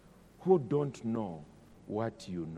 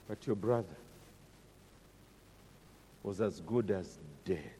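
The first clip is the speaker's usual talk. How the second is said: soft, hushed fatherly reply; gently calm the jealous son; father quietly soothing his elder son; tender father; 5 semitones lower, 8 dB quieter than usual. Acted. Father answers the angry son.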